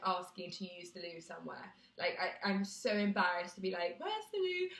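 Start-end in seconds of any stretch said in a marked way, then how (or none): 4.0-4.7 timid high question. Retold or imitated. Timid asking for the toilet.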